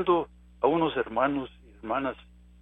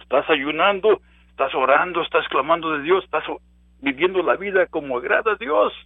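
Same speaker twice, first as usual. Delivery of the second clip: fiery preaching; 3 dB louder than usual. Acted. Preaching questions at congregation.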